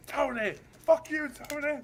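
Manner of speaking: high-pitched put-on voice snapping the insult; mock shouted insult. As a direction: spit it out like the guy in the video. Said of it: "That's what British politics is like".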